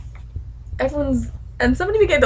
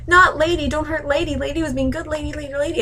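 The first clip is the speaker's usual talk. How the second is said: higher, whiny mimicry of a pleading girl; mock pleading; 5 semitones higher than usual. Sansa begging for her direwolf, mock whine. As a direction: whine it mockingly, running on.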